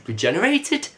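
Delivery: regenerated? asked with a sharp high rise; puzzled question. Retold or imitated. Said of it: "Bill mentioned".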